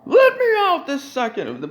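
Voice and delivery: high mock outraged woman; hammed-up high demanding patient. Mock patient yelling at medics, high. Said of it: "She's like".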